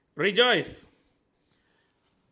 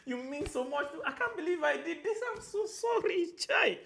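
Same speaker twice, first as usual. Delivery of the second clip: high, laughing, over-the-top apologetic pleading; hammed-up apology; 10 semitones higher than usual. Shown as a demonstration. Refusing the customer's okay, high laughing plea. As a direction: beg forgiveness, pitched way up with little laughs. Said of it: "There's how you even be sorry".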